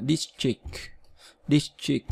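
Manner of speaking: district with stress on the first syllable, two short i sounds; DIS-trict, first syllable strong, short i twice; pronunciation model. Shown as a demonstration. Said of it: "district là hai âm y ngắn".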